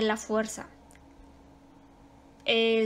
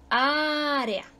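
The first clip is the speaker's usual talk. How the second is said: área with a long opening Á; teacher stretching the accented Á in área; stress on Á; 3 semitones higher than usual. Shown as a demonstration. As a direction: hold the first vowel of área. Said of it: "también tenemos el acento ortográfico".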